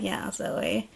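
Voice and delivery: breathy whispered cheer, barely voiced; a hushed little aside; mock whisper. Friends egging Zoe on in a mock whisper.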